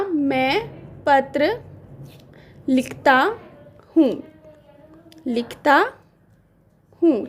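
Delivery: very slow, with long pauses between words; slow dictation. Shown as a demonstration. Teacher dictating the sentence to students, slowly. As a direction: dictate it word by word. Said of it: "तो मैंने वाक्य लिया".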